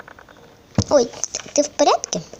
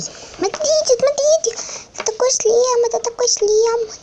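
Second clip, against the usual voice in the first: high excited little toy; squeaky toy voice; 10 semitones higher, 5 dB louder than usual. Pretend. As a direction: chirp excitedly in a tiny voice. (писклявый восторженный голосок)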